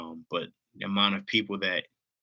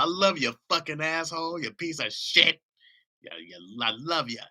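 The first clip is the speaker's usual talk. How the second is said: high-pitched affectionate mock swearing; loving cuss out; 7 semitones higher than usual. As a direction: swear at them like you adore them. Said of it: "Give 'em the most loving cuss out".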